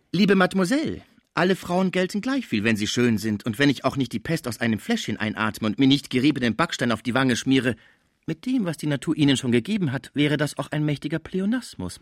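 high, condescending dancer's voice; dancer, mocking condescension. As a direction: patronise her as the witty dancer. (spöttisch herablassende Tänzerin)

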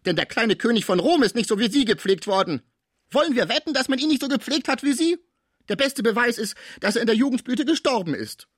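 indignant porter's wife, high and emphatic at the peaks; gekränkte Pförtnersfrau. Acted. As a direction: protest with wounded pride, pitch leaping up. Indignant Cibot in the story. (empörte, gekränkte Pförtnersfrau)

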